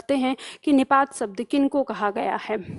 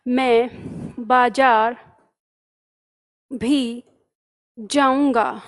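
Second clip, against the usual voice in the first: बाजार pushed, words spaced apart; slow emphasis on बाजार; stress on बाजार; 2 semitones lower, much slower than usual. Shown as a demonstration. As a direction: pause and press बाजार. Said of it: "किसके ऊपर भार आ गया".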